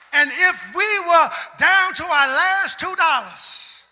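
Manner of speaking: loud, rising sermon delivery with a singsong lift; full-force preaching. Acted. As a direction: preach it out to the congregation.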